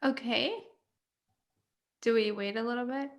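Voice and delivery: usual speaking voice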